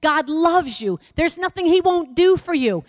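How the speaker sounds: ordinary talk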